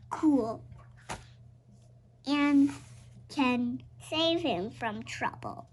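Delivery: ordinary delivery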